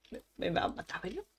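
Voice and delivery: fearful whispered worry; scared whisper. Real, not acted. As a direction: breathe out the fear. Miedo susurrado ante el peligro. (susurro asustado)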